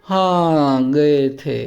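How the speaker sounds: slow हाँ गए थे sinking in pitch to the end; drawn-out weary हाँ गए थे, falling away; tired falling tone. Shown as a demonstration. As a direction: say हाँ गए थे like someone exhausted after a journey. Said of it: "इस तरह से बोलता है वो".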